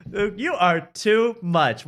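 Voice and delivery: slow, drawn-out host tease; hammed-up slow sign-off; mock host wrap-up. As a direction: savour it like a cheesy host. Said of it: "and I go".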